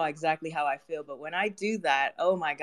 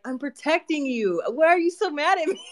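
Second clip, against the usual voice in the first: mock hurt plea; 10 semitones higher than usual. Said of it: "And I'm just like".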